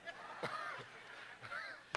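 high, breathy cackling laugh; airy high-pitched giggle; high breathless laugh. Acted. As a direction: laugh in disbelief at the complaint. Mock reaction to member.

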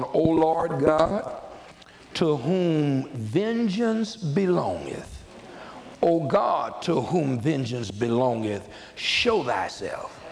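slow, stretched-out psalm recitation; prayerful scripture reading. Acted. Psalmist calling on God to show himself, prayerful. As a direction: draw out each phrase as a solemn appeal. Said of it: "He's literally praying to God".

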